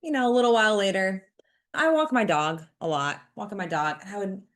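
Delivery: usual speaking voice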